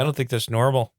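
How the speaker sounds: put-on disapproving Minnesotan in a thick accent; folksy Minnesota accent, rounded vowels; deep Minnesota accent. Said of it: "like, a deep Minnesota accent".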